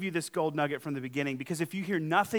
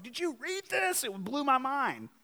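excited; 7 semitones higher, 4 dB quieter than usual. Retold or imitated. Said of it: "doing cartwheels out of the office".

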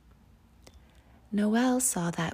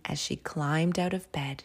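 plain reading voice

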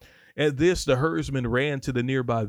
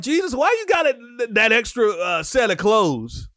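high, loud, incredulous questioning; playacted nosy disciple; puzzled disciples; 10 semitones higher, 6 dB louder than usual. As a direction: ask it like a baffled follower, pitched way up. Voices puzzled disciples questioning Jesus.

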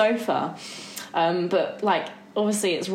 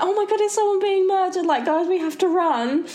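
mock panic; 10 semitones higher than usual. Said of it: "And I'm like".

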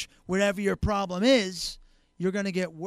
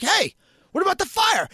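mock alarmed shout; 11 semitones higher, 5 dB louder than usual. Homeowner yelling at firemen.

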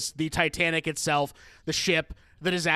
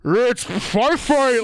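very slow, much louder, warbled and gargled like a bad speaker; garbled tiny speaker; 6 semitones higher, 8 dB louder, much slower than usual. Shown as a demonstration. Recreating the game's sound bite for the co-host, warbled and gargled. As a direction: distort it like a crackly old computer speaker. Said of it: "live recreation of it".

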